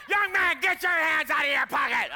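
imitating a rough, high-pitched shout; Mahesh Shavda. Guest preacher singling out the young man, rough imitated shout. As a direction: bellow the order in a rough voice. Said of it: "He talks real rough".

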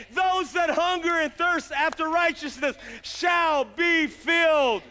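slow, loud scripture proclamation; preaching scripture. Acted. Preacher proclaiming scripture to the congregation, slow and loud. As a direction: proclaim the verse like a revival call. Quotes scripture at full force.

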